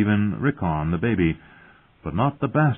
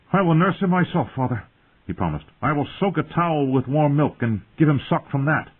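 eager pleading; 8 semitones higher than usual. Acted. Robb eager promise.

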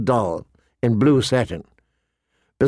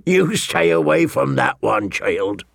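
high character voice laughing through the tale; chuckling old storyteller; 8 semitones higher than usual. Acted. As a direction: tell it high-pitched and amused, dropping to a warning.

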